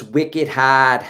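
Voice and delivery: loud mock Boston accent. Host reads Eric's comment aloud, Boston accent. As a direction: belt it out with broad Boston vowels. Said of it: "some of those accents".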